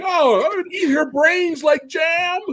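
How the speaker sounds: high, slow goblin shout; high greedy goblin yell. Retold or imitated. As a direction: savor it like a hungry goblin. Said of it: "the other guy's like".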